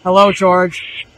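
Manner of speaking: ordinary talk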